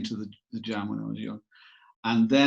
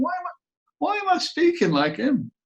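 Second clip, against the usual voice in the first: mock dismay. Mock dismay at own accent.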